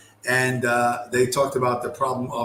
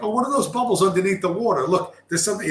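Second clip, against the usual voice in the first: high-pitched mock-naive wonder; higher, wide-eyed mock curiosity; naive curious zebra; 7 semitones higher, faster than usual.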